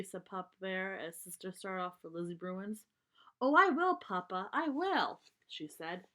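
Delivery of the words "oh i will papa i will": much higher and louder, bubbling; eager promise. Acted. Sister promises Papa as she leaves, eager and much higher. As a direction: promise quickly, already running off.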